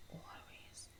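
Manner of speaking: breathy whispered "Always". Real, not acted. To followers welcome on her journey, whispered. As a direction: whisper it close to the mic.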